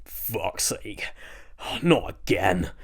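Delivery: sulky grumble, a touch gruff; fed-up son grumbling behind the bin. Acted. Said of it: "Milton grumbled".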